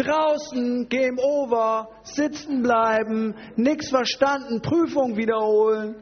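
mock-outraged teacher, high and slow; strenger Lehrer. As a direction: dish out each verdict with drawn-out indignation. Acts teacher failing Petrus. (empörter Lehrer, hoch und gedehnt)

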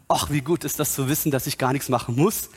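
ordinary talk, nothing marked

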